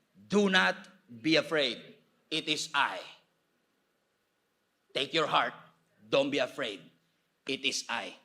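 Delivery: slow, measured reassurance; lightly voiced, steady divine words with spaces between phrases; Jesus. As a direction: speak slowly to calm frightened followers. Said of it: "Ang sabi ni Jesus".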